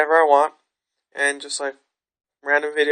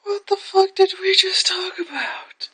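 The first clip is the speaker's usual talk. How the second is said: slow, high, breathless whisper of disbelief; slow shocked whisper; incredulous whisper; slower than usual. Retold or imitated. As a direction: whisper it, stunned. Her stunned inner reaction.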